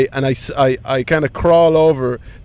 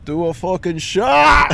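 lad's offer; 5 semitones higher, slower than usual. Retold or imitated. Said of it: "one of them goes".

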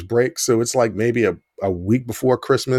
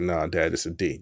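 softer, lower, guilty confession; sheepish admission; 7 semitones lower, 7 dB quieter than usual. Retold or imitated. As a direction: mumble it out ashamed. Son admits his real grade.

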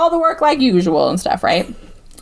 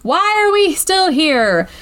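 exasperated plea; 6 semitones higher, slower than usual. Retold or imitated. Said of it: "so she's like".

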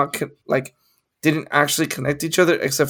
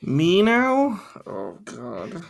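surprised and upset; much slower than usual. Real, not acted. Co-host learns it is their turn, surprised and upset. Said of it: "sound so surprised and upset".

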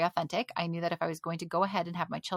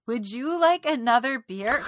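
higher, louder put-on politeness; fakest nice voice; 4 semitones higher, 6 dB louder than usual. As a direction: offer it with exaggerated, phony niceness. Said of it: "use the fakest voice ever".

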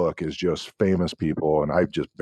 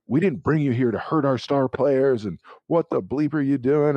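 retold coach yelling; 7 semitones higher than usual. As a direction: imitate an angry coach's dressing-down. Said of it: "yelling at me".

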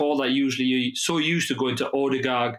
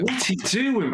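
high-pitched exasperated outburst; angry; 9 semitones higher, much slower than usual. Retold or imitated. Fan reliving a striker's hesitation, angry and high-pitched. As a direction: re-enact angry disbelief, pitched right up. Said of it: "that's how angry I was".

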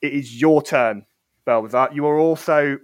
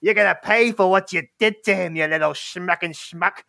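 threatening troll voice, pitched up; snarling troll; 4 semitones higher than usual. Acted. Blue troll threatening the dangling turtle, snarling. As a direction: snarl it like a menacing thug. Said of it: "just snarling at him and is going".

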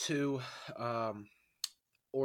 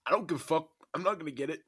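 raised pitch, loud mock quote; mocking a careless kid; 5 semitones higher, 6 dB louder, faster than usual. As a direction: imitate a kid who doesn't care. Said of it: "He's like".

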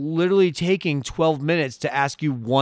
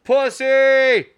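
mocking shout; 9 semitones higher than usual. Real, not acted.

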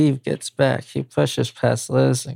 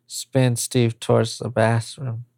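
ordinary delivery

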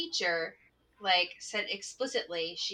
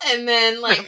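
ordinary delivery

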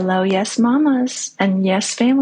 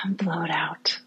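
soft whispered exhale cue; 2 semitones lower, 8 dB quieter than usual. Acted. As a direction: breathe the words out in a whisper. Guiding the exhale.

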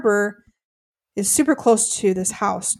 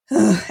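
disgusted ugh; 8 dB louder than usual. Real, not acted. Disgusted by his remarks.

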